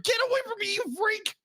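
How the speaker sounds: very high mock panic; retold repulsed cry. Girl rebuffing a creepy admirer, in high mock alarm. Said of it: "No wonder Katie was like".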